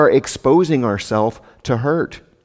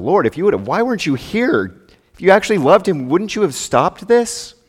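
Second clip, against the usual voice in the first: higher, rising anguished plea; sister's raised, hurt questioning; pleading reproach; 7 semitones higher, 4 dB louder than usual. Retold or imitated. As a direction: beg him to explain. Mary voiced, grieving accusation.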